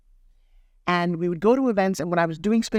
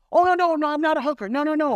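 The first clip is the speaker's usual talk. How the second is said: panicked denial; 11 semitones higher than usual. Retold or imitated. Herself to the stranger, protesting she's no hooker, panicked. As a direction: deny it fast in a panic.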